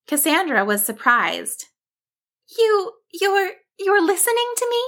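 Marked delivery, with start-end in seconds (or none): 2.6-4.8 very high, halting disbelief; astounded. A narrator's reading. Cassandra cannot believe her friend listens, astounded. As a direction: stammer it in a high little sheep voice.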